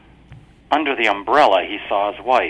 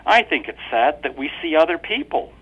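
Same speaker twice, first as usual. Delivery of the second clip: higher, sweetly pointed wife; wife's voice; 7 semitones higher, 4 dB louder than usual. A narrator's reading. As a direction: lean on it innocently. Wife's line.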